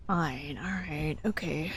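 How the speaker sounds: soft breathy whisper. Real, not acted. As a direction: hush it to a whisper. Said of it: "your dying goat voice A S M R".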